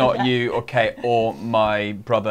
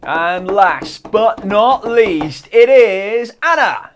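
slow, loud showbiz reveal; hyped announcer; 8 semitones higher, 7 dB louder, much slower than usual. Acted. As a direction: announce it like a game show host. Announcing the last gift.

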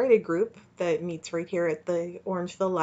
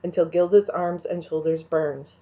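usual speaking voice

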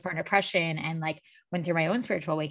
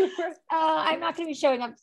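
giddy schoolgirl; 9 semitones higher than usual. Retold or imitated. Retold sneaky cancel call.

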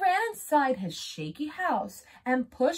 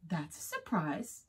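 quiet dry remark; 6 semitones lower, 9 dB quieter than usual. Acted. Puzzled wolf, dry remark.

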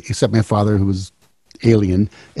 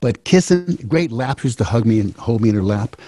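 ordinary talk, nothing marked

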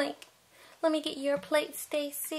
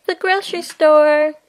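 loud; 12 dB louder than usual. Acted. Barbie announces the plan.

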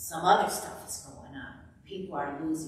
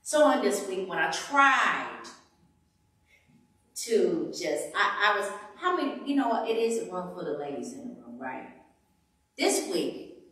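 loud, rising preacher's cry to the ladies; impassioned preaching; 6 semitones higher, 8 dB louder than usual. Acted. Preacher to the women, loud and emotional. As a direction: preach it, pushing louder and higher.

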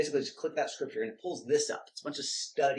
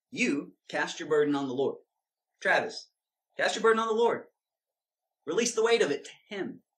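louder, higher, punching "burden" and "weight"; read with emphasis; 3 semitones higher, 4 dB louder than usual. Shown as a demonstration. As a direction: preach it to yourself as an order. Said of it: "I like to read with emphasis".

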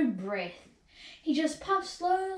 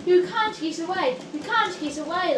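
loud, higher-pitched jeer; gleeful taunting; 5 semitones higher, 7 dB louder than usual. A narrator's reading. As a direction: tease a trapped little engine. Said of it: "laughed the coaches".

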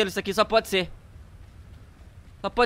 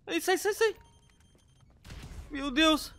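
scared; 8 semitones higher, 5 dB quieter, slower than usual. Real, not acted. Door opens by itself, scared.

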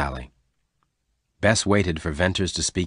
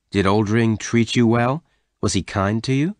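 ordinary delivery, nothing marked

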